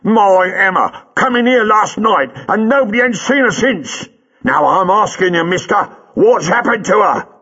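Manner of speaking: high, rough working-class London voice, loud and demanding; a burly cabbie squaring up; cab driver Buller. A narrator's reading. Buller the cab driver to Jago, a high, loud demanding voice.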